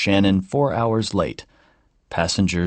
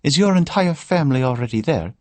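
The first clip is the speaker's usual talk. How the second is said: put-on high Irish old woman's voice, pitched well up; a chatty Irish grandmother complaining lightly; Irish lilt of an older woman; Irish accent; 7 semitones higher than usual. A narrator's reading. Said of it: "in an Irish accent".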